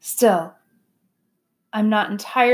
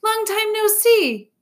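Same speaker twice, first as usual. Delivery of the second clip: put-on delighted old poodle; 11 semitones higher, 3 dB louder than usual. A narrator's reading. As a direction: coo like a fond grandma. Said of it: "in a delighted voice".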